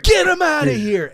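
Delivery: very high imitation of a yelling friend; hammed-up, high-pitched holler; retold frantic yell. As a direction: mimic a fight fan losing it. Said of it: "you hear him say this".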